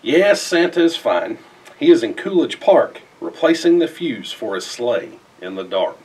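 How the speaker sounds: usual speaking voice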